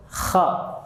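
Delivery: "kha" with a voiceless scrape from the upper throat; letter kha demo. Shown as a demonstration. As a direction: rasp the start of "kha" without voice. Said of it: "Yang kedua huruf". ("kha" dari tenggorokan atas, berdesis serak)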